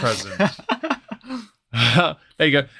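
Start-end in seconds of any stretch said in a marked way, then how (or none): 0.4-1.4 high laugh. Real, not acted. Laughs at own joke.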